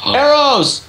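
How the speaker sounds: thrilled shout of discovery; excited gasp. Real, not acted. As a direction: gasp then cry out. Gasping discovery.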